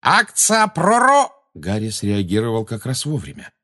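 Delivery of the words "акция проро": high loud shriek; shrieked spell. A narrator's reading. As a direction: screech the spell. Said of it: "пожирательница смерти взвизгнула".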